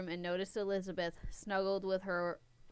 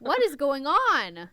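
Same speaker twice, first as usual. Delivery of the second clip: loud, high, slow exclamation; real exasperated bewilderment; baffled outburst; 7 semitones higher, 11 dB louder, slower than usual. Real, not acted. Reader lost in the confusing story, loudly baffled.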